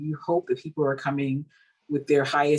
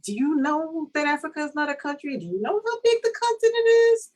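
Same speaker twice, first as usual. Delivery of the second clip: incredulous retort; 12 semitones higher than usual. Retold or imitated. To dismissive agents, high incredulous disbelief. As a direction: pitch it way up in disbelief. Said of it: "And I'm just like".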